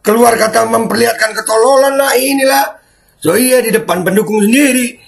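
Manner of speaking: high, indignant ranting; exasperated mockery. Real, not acted. Reactor scolding Prabowo for bravado before supporters, exasperated mockery.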